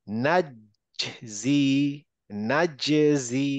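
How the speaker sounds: "নাজজি" very slow, first জ released before zi; qalqalah echo. Shown as a demonstration. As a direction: hold "নাজজি" at the জ, then bounce into zi. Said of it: "এরকম হবে".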